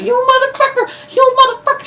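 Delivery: very high-pitched put-on angry voice; pissed-off retold cry. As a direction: imitate a furious woman in squeaky falsetto. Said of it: "she's all pissed and stuff".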